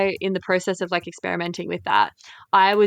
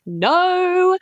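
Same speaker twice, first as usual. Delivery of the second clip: emphatic no; 12 semitones higher, 3 dB louder than usual. Retold or imitated. Mock reaction to profile.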